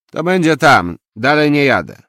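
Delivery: loud, high, defiant announcement; angry refusal. A narrator's reading. Taxi driver refuses to go further, loud and defiant. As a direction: refuse flatly at full volume.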